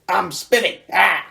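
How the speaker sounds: high, loud squeaky goblin shout. Retold or imitated. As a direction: squeal his own name as a silly goblin. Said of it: "he talked like".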